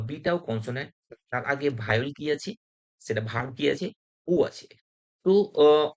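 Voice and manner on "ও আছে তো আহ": normal talking voice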